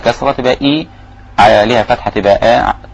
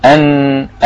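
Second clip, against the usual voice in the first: ordinary talk, nothing marked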